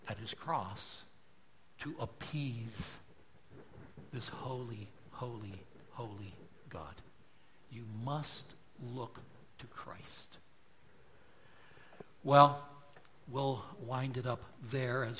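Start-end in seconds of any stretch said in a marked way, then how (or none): 4.1-10.4 whisper. Real, not acted. Sermon climax drops to whisper.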